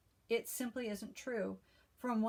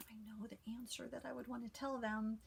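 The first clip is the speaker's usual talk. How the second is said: whisper; 7 dB quieter, faster than usual. Retold or imitated. Whispered inner thought.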